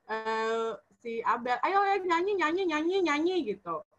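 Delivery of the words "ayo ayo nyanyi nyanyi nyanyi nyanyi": high, bouncy repeated urging; eager urging. Retold or imitated. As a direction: imitate a child egging everyone on to sing. Child urging everyone to sing.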